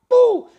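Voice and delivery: very high, loud shouted "pum" blast; cartoon explosion cry; loud bang. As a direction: blurt out the explosion noise. Lightning blast sound.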